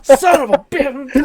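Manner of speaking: high, loud, drawn-out mock scream; comic rage yell; screaming profanity. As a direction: scream it at the computer, hammed up. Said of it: "then I scream profanities at my computer".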